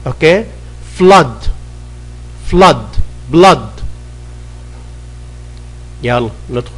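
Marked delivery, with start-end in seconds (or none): 1.0-3.7 crisp classroom drill; pronunciation drill. Shown as a demonstration. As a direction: say each word like a teacher for the class to repeat. Drill of heavy a.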